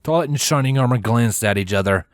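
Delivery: ordinary delivery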